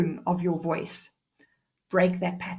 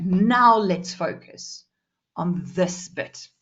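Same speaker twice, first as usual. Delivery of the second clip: slow, deliberate, spaced-out words; slow right down to make the point land; slowed down for focus; 2 semitones lower, much slower than usual. Shown as a demonstration. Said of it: "sometimes speed it up".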